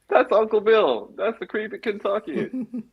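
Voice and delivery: high-pitched retold exclamation; retold excited announcement. Retold excited answer to wife.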